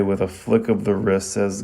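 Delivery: usual speaking voice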